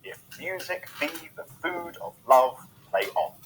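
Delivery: "If music be the food of love, play on" with MU, BE, FOOD, LOVE, ON stressed; iambic stress. Shown as a demonstration. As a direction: recite "If music be" in measured weak-strong beats. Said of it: "a short line for stressed ones".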